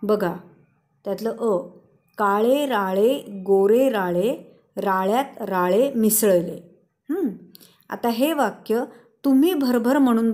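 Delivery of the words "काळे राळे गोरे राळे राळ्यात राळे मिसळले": slow, measured reading of a tongue twister; slow tongue-twister demo. Shown as a demonstration. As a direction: say it slowly, word by word. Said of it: "आधी सावकाश म्हणून बघायची आहे".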